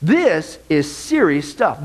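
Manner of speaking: ordinary delivery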